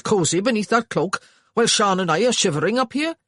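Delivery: loud, brusque brother; gruff Welsh brother. A narrator's reading. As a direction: hurry him up. Hugh loud.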